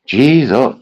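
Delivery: ordinary delivery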